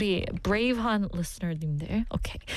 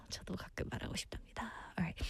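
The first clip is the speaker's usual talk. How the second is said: quick whisper; 2 semitones lower, 16 dB quieter, much faster than usual. Real, not acted. Confiding aside.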